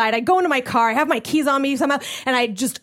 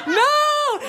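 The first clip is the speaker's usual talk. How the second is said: laughing no. Retold or imitated. Dismayed reaction mid-laugh.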